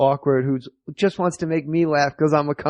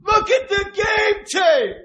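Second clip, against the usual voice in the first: mock coach yell; slower than usual. Coach scolding the players.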